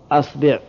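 أصبع as "asbi'", a first, i after ba; fatha then kasra. Shown as a demonstration. Teacher completing the fatha set, أصبع as "asbi'". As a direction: say أصبع with opening a and second vowel i. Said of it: "والكسر".

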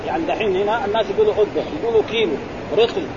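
ordinary talk

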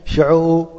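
ordinary talk

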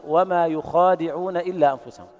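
chanted يخادعون with a stretched a; with alif. Shown as a demonstration. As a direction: recite يخادعون with a long a, yukhaadi'un. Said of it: "يقرؤون".

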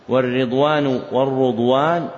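والرضوان said first as "ridwan" with the ra given kasra, then as "rudwan" with damma; two readings shown. Shown as a demonstration. Teacher shows students both readings of والرضوان, ridwan then rudwan. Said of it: "والرضوان تكسر راؤه وتضم".

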